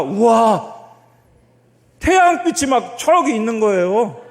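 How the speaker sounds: loud, high wow, then slow wonder; big exclamation of wonder; awed amazement. Retold or imitated. Reliving awe above the clouds.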